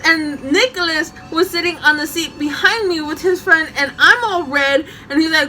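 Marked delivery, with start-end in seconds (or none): none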